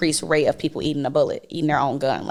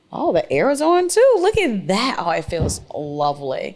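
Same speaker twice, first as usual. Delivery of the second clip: delighted; 2 semitones higher than usual. Real, not acted. Delight at air conditioning.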